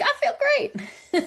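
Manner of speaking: tipsy guest insisting she feels great; mock drunk. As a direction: drawl it out happily tipsy. Drink suddenly hitting you.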